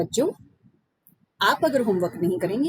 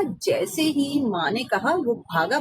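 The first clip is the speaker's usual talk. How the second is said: ordinary delivery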